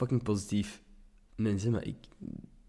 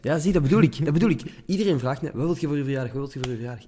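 excited; 6 semitones higher, 7 dB louder, faster than usual. Real, not acted. Excited rant about birthday questions.

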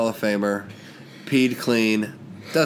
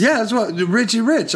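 high excited burst of recognition; 10 semitones higher than usual. Real, not acted. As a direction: say it like you just remembered.

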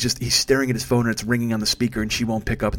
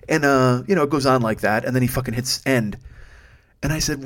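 ordinary talk, nothing marked

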